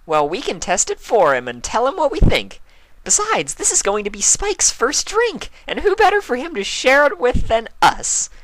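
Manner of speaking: very high, bubbly pony voice; Pinkie Pie voice. A narrator's reading. Pinkie offering Spike a drink, high and bubbly. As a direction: offer it with glee.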